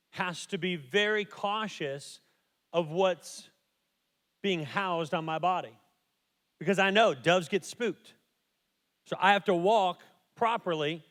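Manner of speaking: loud, slow, fervent preaching; impassioned preaching. Real, not acted. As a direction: preach it with full fervour. Preaching at full force.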